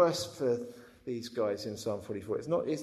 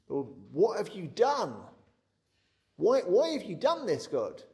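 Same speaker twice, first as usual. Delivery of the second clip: higher, louder accusing prayer; anguished complaint to God; 5 semitones higher, 5 dB louder than usual. Pretend. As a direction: cry out at God, why. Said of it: "I'm like, "I can't really imagine praying like this".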